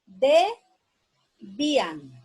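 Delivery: Debían drawn out very slowly, syllable by syllable; dictated slowly. Shown as a demonstration. Teacher dictating Debían slowly to pupils. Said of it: "separar en sílabas".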